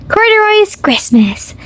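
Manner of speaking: ordinary delivery, nothing marked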